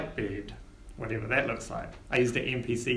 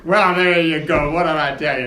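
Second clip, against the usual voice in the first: gloating; 3 semitones higher, 10 dB louder than usual. Acted. Flagstaff gloating.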